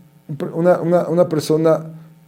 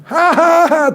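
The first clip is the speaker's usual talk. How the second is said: mock girlish laughter; 13 semitones higher, slower than usual. Classmates laughing at fortune.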